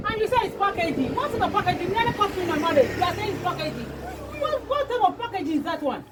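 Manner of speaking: outraged. Acted. Woman berating someone for saying it's packaging, outraged. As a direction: demand an explanation in furious disbelief.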